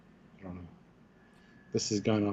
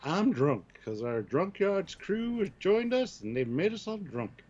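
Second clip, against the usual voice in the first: unhinged; 6 semitones higher, slower than usual. Acted. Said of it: "You sound a bit, um, unhinged".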